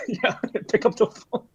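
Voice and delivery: laughing retelling. Retold or imitated.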